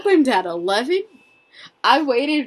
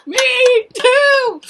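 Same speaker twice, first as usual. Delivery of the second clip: triumphant shout; 11 semitones higher, much slower than usual. Retold or imitated. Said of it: "We were like".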